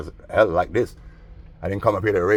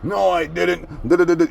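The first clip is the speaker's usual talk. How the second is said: much higher, whining denial; mock whiny denial; 10 semitones higher, 3 dB louder than usual. Mocking the wallet thief denying theft, high whine. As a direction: deny it like a caught kid.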